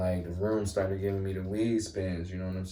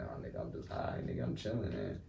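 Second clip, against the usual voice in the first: low, soft, offhand quote of himself; laid-back retort; 8 semitones lower, 8 dB quieter than usual. Retold or imitated. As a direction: play it down quietly, like it's nothing. Retelling what he would calmly answer.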